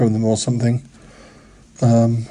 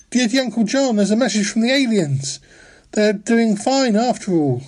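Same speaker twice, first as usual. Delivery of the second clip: relaying the message; 7 semitones higher than usual. Acted. Reading the message to Uncle John.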